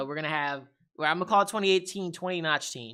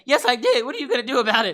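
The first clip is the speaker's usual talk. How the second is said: sassy Google; 6 semitones higher, 7 dB louder, faster than usual. Retold or imitated. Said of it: "It's like".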